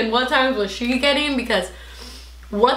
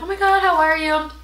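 gushing friend; 7 semitones higher than usual. Retold or imitated.

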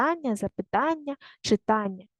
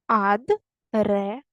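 Адреса broken into slow separate syllables; much slower than usual. Shown as a demonstration. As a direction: say Адреса in slow chunks with pauses between. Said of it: "Такі склади". (по складах, повільно)

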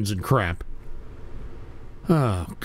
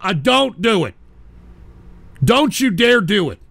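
louder, higher warning; alarmed yell; 6 semitones higher, 6 dB louder, slower than usual. Real, not acted. Host yelling at a caller not to play it. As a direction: stop them right now. Host stops replayed clip.

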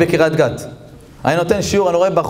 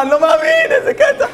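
high, laughing shout of disbelief; thrilled disbelief. Acted. Husband shouting with delight at his wife's news. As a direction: yell it, laughing, unable to believe it.

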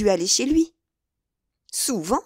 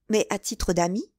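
plain reading voice

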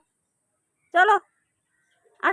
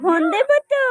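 calling out; 6 semitones higher than usual. Real, not acted. Calling baby, cry shout heard. (শিশুকে উঁচু গলায় ডাকা)

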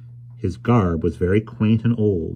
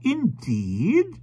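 put-on old stranger's voice. A narrator's reading. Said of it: "observed the stranger".

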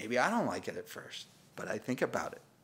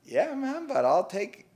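mock casual bargainer; 8 semitones higher, 6 dB louder than usual. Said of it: "if the guy has said".

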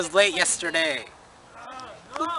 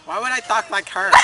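ordinary talk

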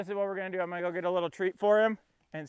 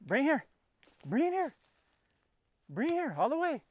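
pitched-up, singsong coaxing; high coaxing call; 8 semitones higher than usual. Real, not acted. Owner coaxing his distracted dog to bring the ball, high coaxing call. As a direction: coax him back with a high voice.

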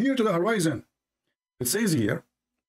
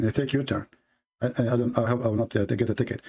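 ordinary delivery

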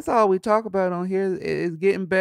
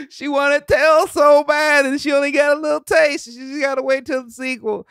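laughing while talking; 11 semitones higher, 8 dB louder than usual. Real, not acted. Comedian laughing about the girl wanting Tails, laughing speech. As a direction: laugh through it.

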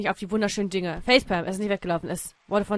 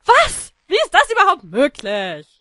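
high loud what, then slower; acted shock; shocked outburst; 5 semitones higher, 7 dB louder, slower than usual. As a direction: react in outrage. Reading shocked character line.

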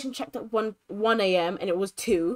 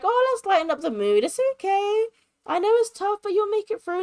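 high, perky reassuring voice; cheery comforting friend; 10 semitones higher than usual. Acted. Friend trying to lighten her labor mood, high and cheery. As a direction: play a relentlessly upbeat friend, pitched way up. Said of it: "and Lauren's like".

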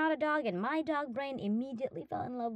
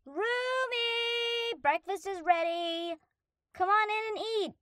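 calling out; 10 semitones higher, slower than usual. Acted. Calling roommate to breakfast.

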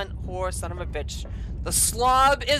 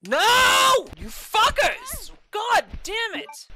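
high, drawn-out furious shout; anguished fury; 11 semitones higher, 4 dB louder, much slower than usual. Real, not acted. Player attacked by moths, anguished furious screaming. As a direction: scream it in real frustration.

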